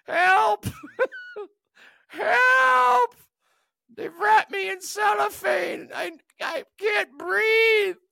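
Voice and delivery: squeaky high panicked shouting; tiny toy bear screaming it can't breathe; cute bear crying for help. Pretend. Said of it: "Just a little cute bear".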